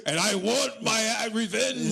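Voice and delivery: high theatrical villain vow. Acted. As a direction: declare revenge like a cartoon villain. Comic vow of revenge.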